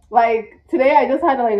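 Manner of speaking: ordinary talk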